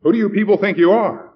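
loud, high, indignant challenge; outraged. A narrator's reading. Stern man confronting the box holders, outraged. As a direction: demand it angrily of the crowd.

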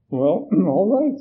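slow, high, hesitant agreement with throat-clearing; reluctant. A narrator's reading. Nervous man agreeing to go up front, reluctant. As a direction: give in haltingly after clearing the throat. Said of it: "reluctantly getting up".

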